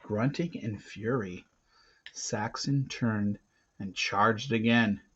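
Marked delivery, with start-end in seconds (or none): none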